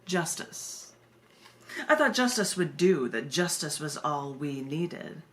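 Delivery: slow, low, solemn poetry reading; poem reading. Acted. Poet reading her justice poem to a live audience, solemn and low. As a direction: read it like a grave spoken-word poem.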